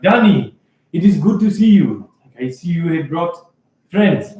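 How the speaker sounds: game master's attempt at an African-accented greeting; almost African accent. Host greets returning companion and friends, almost African accent. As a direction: greet them formally in a roughly African accent. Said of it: "he's got, like, this deep voice, like, almost African".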